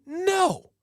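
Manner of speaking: high, drawn-out no; emphatic no. Real, not acted. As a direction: answer yourself with a high-pitched no. Answers own rhetorical question.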